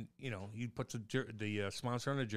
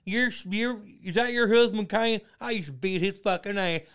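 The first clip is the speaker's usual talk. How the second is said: high, very loud put-on voice; mock loudmouth braggart; 9 semitones higher, 11 dB louder, slower than usual. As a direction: act out an obnoxious old schoolmate, drawn out and loud. Acts out someone approaching a couple.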